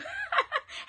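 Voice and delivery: short, loud, high laugh; real high cackle; amused laugh. Real, not acted. Laughs at repeated phrase.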